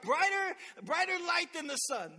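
high, loud, amazed; preacher acting out Saul's awe; awed exclamation. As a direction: marvel at the light.

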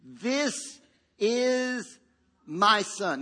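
slow, drawn-out proclamation; God's solemn announcement from heaven; voice of the Father. Acted. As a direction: declare it word by word, with long held vowels. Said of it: "a voice".

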